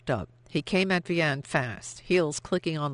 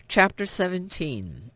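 ordinary delivery, nothing marked

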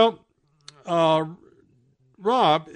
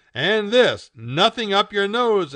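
high, loud little announcement; emphatic instruction; 9 semitones higher, 9 dB louder, slower than usual. A narrator's reading. Barbara puts goggles on Marmaduke, emphatic. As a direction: declare it firmly to the dog. Barbara continues outfitting the dog.

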